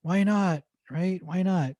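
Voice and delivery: put-on high-pitched, eager inner child; inner kid. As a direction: pitch it up like a kid dreaming big. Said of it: "the kid inside of me is going".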